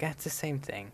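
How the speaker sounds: deflated, quiet, let-down sigh; bored. Retold or imitated. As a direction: say it like the fun's gone. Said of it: "I'm like".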